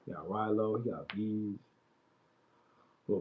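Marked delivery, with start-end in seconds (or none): none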